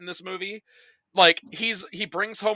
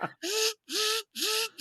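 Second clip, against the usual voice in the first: high mock laugh; 6 dB quieter than usual. Mimicking the old lady.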